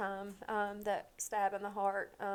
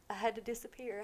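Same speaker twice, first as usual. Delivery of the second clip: normal talking voice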